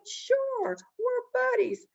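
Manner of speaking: very high, breezy reassurance; sly rabbit acting friendly; chummy trickster Rabbit. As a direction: play the helpful pal in a high voice. Rabbit falsely reassures Possum.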